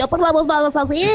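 high put-on sample voice; client's chosen voice. Shown as a demonstration. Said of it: "el cliente decide".

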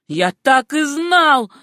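loud, slow triumphant exclaim; I knew it, shouted. A narrator's reading. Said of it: "воскликнул Верхем". (громкое медленное восклицание)

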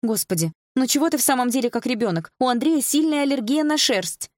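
high, reproachful mother; mother's voice. A narrator's reading. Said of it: "раздался мамин голос".

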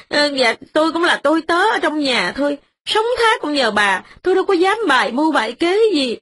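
high, rushed, mildly tearful begging; beg, half crying; pleading through tears. A narrator's reading. Tenant woman kneeling, begging the mistress for mercy. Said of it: "vừa khóc vừa nói".

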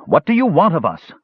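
high-pitched, forceful demand; calling up. A narrator's reading. Tanis demanding answers from those above, calling out. Shouts up from the pit.

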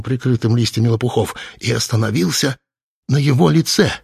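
ordinary delivery

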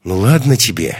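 plain reading voice

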